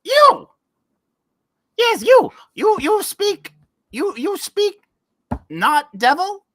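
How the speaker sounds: very high, squeaky goblin voice; little fire goblin. Acted. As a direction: call out like a tiny excited imp. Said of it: "these guys are little fire goblins".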